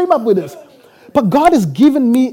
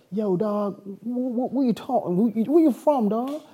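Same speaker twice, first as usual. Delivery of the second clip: imitated New York street talk, softer; mock New York slang; 9 dB quieter than usual. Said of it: "sends me to New York, where people are like".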